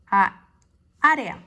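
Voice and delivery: "A, área" with the first A set apart by a pause; separated syllables. Shown as a demonstration. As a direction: sound out "A, área" in pieces. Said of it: "las separa".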